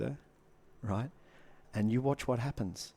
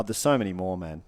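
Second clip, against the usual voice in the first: ordinary talk, nothing marked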